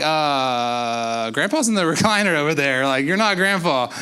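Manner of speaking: lightly voiced baffled relative with a laugh; half-laughing, not buying it; confused family member. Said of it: "And you're like".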